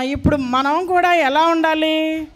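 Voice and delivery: usual speaking voice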